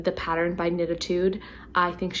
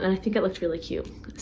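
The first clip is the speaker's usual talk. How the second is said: normal talking voice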